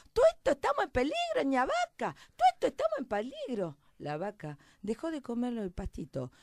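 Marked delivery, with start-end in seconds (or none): 0.1-3.8 high, loud rustic little mouse; alarmed shouting. Acted. Little mouse warning all the farm animals, frantic shouting. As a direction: cry the alarm across the farm, first word shrieked high.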